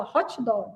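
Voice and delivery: "hot dog" as "hótchi dóg"; Brazilian pronunciation. Retold or imitated. Mother imitating her old mistake, Brazilian pronunciation. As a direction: add a "tchi" to the end of "hot". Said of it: "A mamãe falava".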